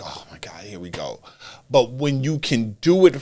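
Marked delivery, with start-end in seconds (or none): 0.0-1.2 whispered, low weary groan of words; weary aside. Acted. Fed-up viewer reacting to the promo, weary whisper. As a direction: let it drop to a resigned whisper.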